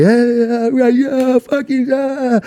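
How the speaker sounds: high, drawn-out mock whooping; hammed-up high party cheer; imitating cheering friends. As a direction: whoop it up like a limo full of pals. Friends celebrating in the limo.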